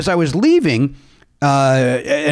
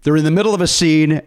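normal talking voice

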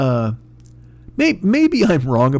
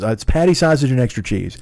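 normal talking voice

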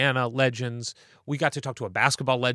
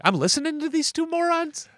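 incredulous. Pretend. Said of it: "they would be like".

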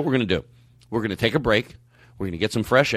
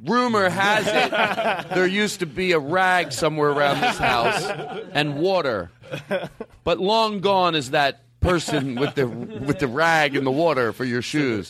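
very slow, higher, laughing through it; mock old-timer storyteller; 3 semitones higher, slower than usual. The worker answering about the rag, slow mock legend. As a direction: spin it like a legend, drawn out. Voicing the worker's grand reply.

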